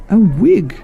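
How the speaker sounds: high, loud frog lady cry; high loud eureka; excited guess. Acted. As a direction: burst out with it. Missus Frog exclaims.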